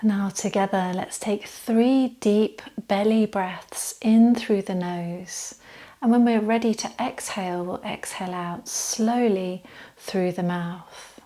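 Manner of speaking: calm meditation voice with long, unhurried pauses; settled, even yoga-teacher relaxation voice; guided body scan relaxation. Acted. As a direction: gently guide them into stillness, leaving space to breathe.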